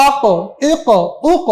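আক, ইক, উক each ending in a bounced q; qalqalah bounce. Shown as a demonstration. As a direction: push each final q so it echoes briefly. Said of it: "এই যে একটা ধাক্কা দিচ্ছি".

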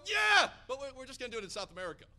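high, loud start then a fast throwaway; mock reply. Preacher mimicking the leaders, mock hedging reply. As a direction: imitate the hedging crowd. Mocking the leaders' limited vision.

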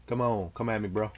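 usual speaking voice

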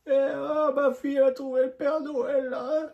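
high loud imitation of a woman; man doing a woman's voice. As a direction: mimic the mother, high and excited. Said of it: "t'es en train d'imiter ma mère?".

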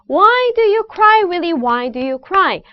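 sing-song chant, high opening WHY stepping down to a falling cry; rhythmic tongue twister. Shown as a demonstration. Coach leads students in tongue twister, lively rhythmic chant. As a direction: bounce "Why do you cry" along, starting high and dropping. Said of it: "이 리듬".

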